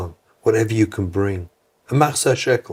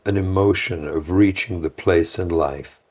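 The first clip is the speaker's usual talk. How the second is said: ordinary talk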